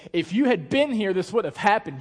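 loud, pained complaint; acted reproach. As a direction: burst out in mock accusation. Acts Mary's reproach to Jesus.

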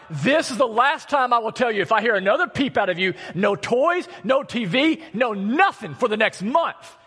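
imitating a fed-up dad laying down the law; retold angry scolding. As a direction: scold the kids with a touch of real heat. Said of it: "storming across the house".

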